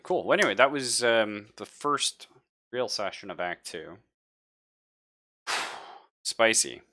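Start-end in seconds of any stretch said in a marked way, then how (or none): none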